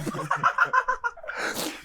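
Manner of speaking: high laugh. Real, not acted. Laugh after kid impression.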